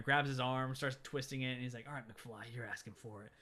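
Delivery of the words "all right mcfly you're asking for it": low, soft imitation of a bully's threat; understated threat. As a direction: drop it low and quiet, lightly menacing. Biff threatens George, retold.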